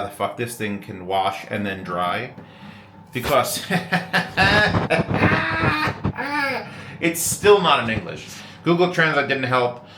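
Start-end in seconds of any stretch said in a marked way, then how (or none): 3.7-6.9 a long high laugh at himself; high burst of laughter. Real, not acted. Laughing at the German washing machine, high laugh. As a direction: crack up, pitched way up.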